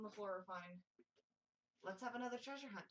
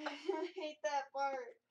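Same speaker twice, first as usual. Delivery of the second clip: slow, laughing through the words; 5 semitones higher, 9 dB louder, much slower than usual. Real, not acted. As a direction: laugh off the fright. Laughing after a scary part.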